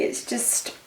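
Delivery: ordinary talk, nothing marked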